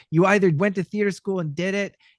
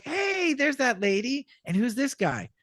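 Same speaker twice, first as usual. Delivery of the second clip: pitched-up retold greeting; excited recognition; 9 semitones higher than usual. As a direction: pipe up high on spotting someone. Acting own recognition at audition.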